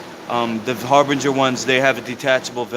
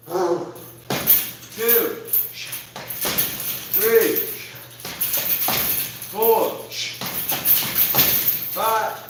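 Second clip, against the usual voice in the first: excited counting; 9 semitones higher, much slower than usual. Real, not acted. Counting push-ups aloud.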